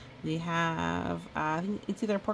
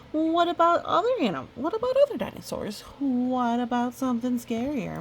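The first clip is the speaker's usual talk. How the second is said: high, fast excited squeal, louder; eager friend; 7 semitones higher, 5 dB louder than usual. Acted. Kraken interrupts Goose's story, high excited squeal. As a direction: squeal it like an impatient little kid. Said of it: "Kraken pointed out".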